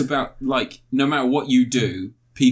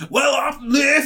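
high, loud put-on Scottish; hammed-up Scottish voice; mock Scottish accent; 13 semitones higher, 4 dB louder, slower than usual. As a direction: do a bad Scottish accent as Michael Gove. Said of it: "Was that your Scottish accent? / No, that was his Scottish accent".

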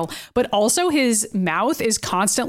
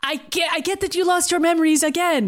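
higher, slightly put-on Kurt, patiently repeating his name; lightly voiced Kurt introducing himself to Logan; 6 semitones higher than usual.

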